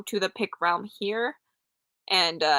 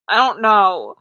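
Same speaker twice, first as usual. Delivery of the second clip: louder, half-hammed groan of a reply; mock exasperated blurt; 10 dB louder, slower than usual. Streamer retelling her answer to teasing boyfriend, hammed-up. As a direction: blurt it out, a little defensive. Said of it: "I'm like".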